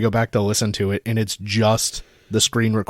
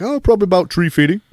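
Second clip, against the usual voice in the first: much higher, louder cartoon dad voice; [name]'s dad impression; 9 semitones higher, 5 dB louder, faster than usual. Messing with a scam caller in a cartoon dad voice. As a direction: stretch the Oh like a goofy cartoon dad. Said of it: "in the voice, I'm like".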